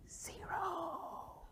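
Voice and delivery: high, soft whisper; drawn-out, whispered punchline; hushed reveal. Shown as a demonstration. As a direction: whisper the shocking answer. Reveals the trivia answer hushed.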